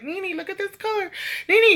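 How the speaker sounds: put-on high, childlike call for auntie; little niece calling. Niece interrupting to show a polish color, high childlike call. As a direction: imitate a small girl tugging for attention.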